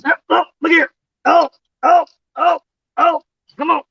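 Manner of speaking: excited cries. Real, not acted. Excited cries hooking big fish.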